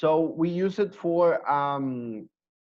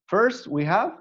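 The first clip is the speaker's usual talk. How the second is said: ordinary talk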